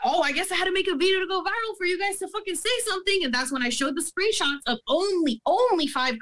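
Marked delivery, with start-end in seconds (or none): 0.0-3.2 much higher, fast, sarcastic sneer; snide jab at the company, fast and high. Retold or imitated. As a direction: sneer it out, mocking them.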